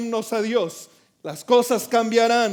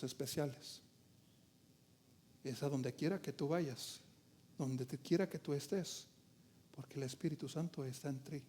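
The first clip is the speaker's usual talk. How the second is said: much softer, lower and hushed; soft intimate preaching; 7 semitones lower, 15 dB quieter than usual. Acted. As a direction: draw them in close, hushed. Soft closing exhortation. (exhortación íntima, en voz muy baja)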